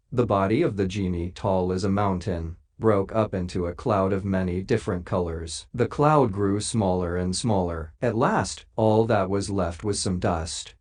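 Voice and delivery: ordinary delivery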